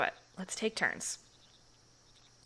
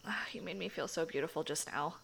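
ordinary talk

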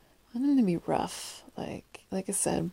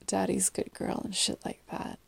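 usual speaking voice

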